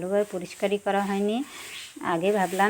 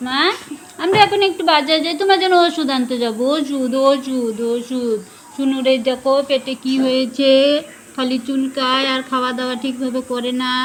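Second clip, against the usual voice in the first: put-on baby talk; 4 semitones higher, 9 dB louder than usual. Baby talk to sick pet.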